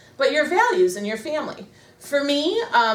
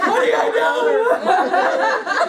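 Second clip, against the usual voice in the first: mock helpless wail; 9 semitones higher, 5 dB louder, much slower than usual.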